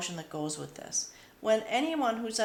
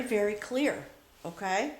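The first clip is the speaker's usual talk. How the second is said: ordinary talk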